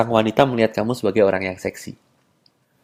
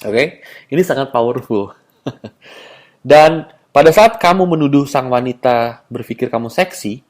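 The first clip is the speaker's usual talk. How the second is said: ordinary delivery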